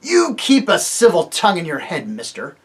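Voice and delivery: loud warning dwarf; surly dwarf. Acted. The dwarf snaps back at Tirian, loud and surly. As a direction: warn him off.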